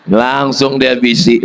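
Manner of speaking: normal talking voice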